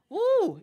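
high whoop of joy; excited woo. Real, not acted. Vlogger whooping at the draft pick, high. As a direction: let out a happy whoop.

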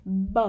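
low voiced "buh" for B; short voiced B, no burst of air; unaspirated b. Shown as a demonstration. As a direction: demonstrate B softly, with no puff. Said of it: "Chúng ta phải đọc như thế".

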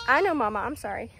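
ordinary talk, nothing marked

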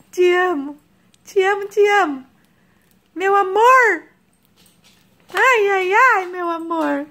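high, tender cooing; mother coos love to her daughter. Real, not acted. Mother cuddling baby.

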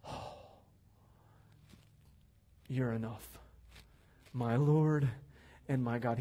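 hushed, low, with long pauses; imitated awe. Preacher voices Thomas coming before Jesus, hushed low awe. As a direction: let the realization sink in softly. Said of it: "he just came and he's like".

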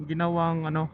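ordinary talk, nothing marked

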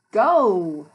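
loud, drawn-out "go"; storybook call-out. A narrator's reading. Teacher reads the bus book to children, loud call-out. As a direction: call it out big for young listeners. Reading picture book aloud.

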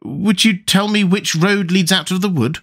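put-on a polite little girl saying sorry; lightly voiced young Alice; Alice's voice. Alice asks the way.

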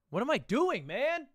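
high, loud frustrated cry at himself; frustrated outburst. Real, not acted. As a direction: yell at yourself for being stuck. Stuck on a word puzzle.

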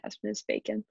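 ordinary delivery

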